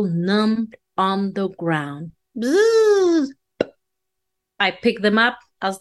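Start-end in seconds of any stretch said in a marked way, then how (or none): 2.4-3.3 high buzzing insect noise; fly buzz. Acted.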